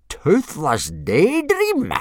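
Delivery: loud, high, slow disbelief; blustering. A narrator's reading. Instructor repeating the boy's answer, blustering. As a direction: stretch it out in blustering outrage. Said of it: "blustered Gobber".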